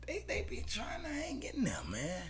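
very high, half-whispered; mock them softly in falsetto. Real, not acted. Bettor mocking Eastern Michigan, high whisper. Teasing weak team.

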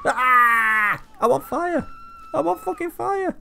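high, loud panicked cries; loud, high mock panic on fire; panicked screaming. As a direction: scream for help while burning. Goat is on fire.

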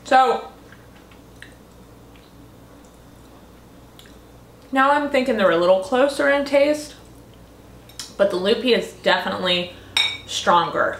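chewing between words; tasting food while talking; mouth partly full. Real, not acted. Seen 0: holds pasta bowl and fork, lips pressed mid-talk like chewing.

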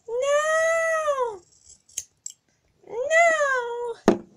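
very high repeated wails; desperate wails. Acted. Pony wailing while her hair is cut, very high. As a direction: wail no again and again up high.

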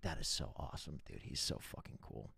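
hushed awe. Retold or imitated. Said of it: "And I was like".

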